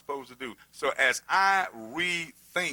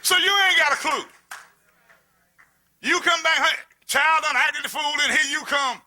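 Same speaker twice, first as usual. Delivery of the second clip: high, loud, shouting; booming preacher shouting at the congregation; fiery preaching; 9 semitones higher, 5 dB louder than usual. Acted. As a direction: drive it home with full force. Preaching at full force.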